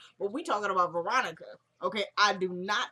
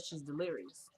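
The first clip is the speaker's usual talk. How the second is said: normal talking voice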